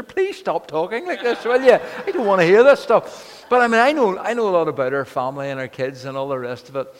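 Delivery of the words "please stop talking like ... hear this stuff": louder, higher hammed-up plea to stop; mock pleading. Minister to chatty hairdresser, hammed-up loud plea. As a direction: beg her to stop, half laughing.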